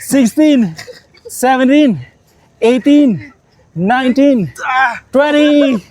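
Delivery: strained counting mid push-up; doing push ups. Real, not acted. Counting reps mid push-up set.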